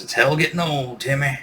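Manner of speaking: put-on old Kentucky grandma voice; Kentucky accent. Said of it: "with her, uh, Kentucky accent".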